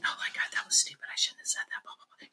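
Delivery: quick, hushed whisper; barely audible muttered self-criticism; whispered self-scolding. Acted. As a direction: whisper it fast, like inner self-talk. Acts out unnoticed negative self-talk.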